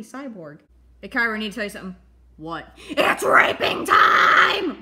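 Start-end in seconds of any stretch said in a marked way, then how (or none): 2.9-4.8 slow, loud, drawn-out yell; slow high gleeful bellow; gleeful shout. Acted. Character yells the dare.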